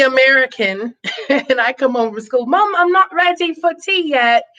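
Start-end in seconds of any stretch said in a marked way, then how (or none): 2.5-4.4 put-on child's British accent. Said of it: "the only person in the house with a British accent".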